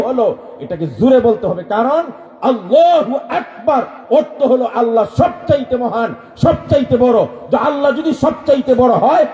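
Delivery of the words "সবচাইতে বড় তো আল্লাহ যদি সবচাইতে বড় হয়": fiery preaching. Acted. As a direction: raise the voice above all. Preaching at full force. (উচ্চস্বরে জোরালো ওয়াজ)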